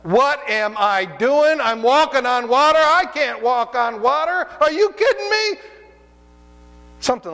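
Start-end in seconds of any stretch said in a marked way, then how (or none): 0.0-5.6 high, loud, drawn-out incredulous outcry; panicked reality check. Acted. Preacher voices Peter doubting he can walk on water, incredulous outcry. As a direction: exclaim in rising disbelief, pitched way up. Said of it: "a good dose of reality that says".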